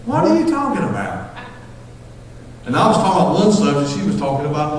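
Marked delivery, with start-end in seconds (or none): 0.1-1.3 high-pitched mock bewilderment; baffled retort. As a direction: imitate a wife completely lost by the chatter. Wife baffled by his rambling.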